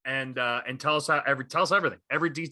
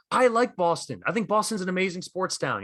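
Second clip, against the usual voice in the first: higher, measured praise in his voice; 6 semitones higher than usual. Retold or imitated. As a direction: concede the point calmly, pitched up. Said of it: "where he was like".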